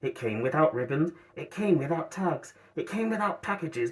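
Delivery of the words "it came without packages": usual speaking voice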